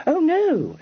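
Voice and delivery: high, bright exclamation of assurance; assuring. A narrator's reading. Kitty reassuring Freddy, high and eager. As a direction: rise high to reassure him. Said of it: "she assured him".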